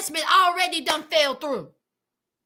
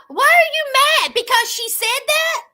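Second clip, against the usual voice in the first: higher and louder, baffled at him; incredulous outburst at the TV; exasperated; 6 semitones higher, 5 dB louder than usual. Real, not acted. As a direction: call out at the screen in disbelief. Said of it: "exasperated at the screen".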